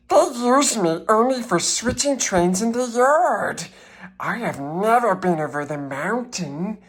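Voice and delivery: high, modest little engine; small engine voice. A narrator's reading. Little engine tells the toys, high and modest. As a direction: explain modestly in a tiny voice.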